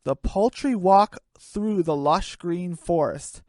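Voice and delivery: ordinary talk, nothing marked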